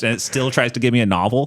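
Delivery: ordinary talk, nothing marked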